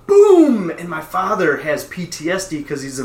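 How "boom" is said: loud, high imitated crash; loud boom. Bowling ball hits the floor above, shouted boom. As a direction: shout the bang of a dropped ball.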